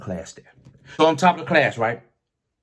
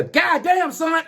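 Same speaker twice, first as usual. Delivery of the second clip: high mock yell of the instructor; startling yell; much slower than usual. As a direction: bark it out high like the old instructor sneaking up. Instructor startles him in booth.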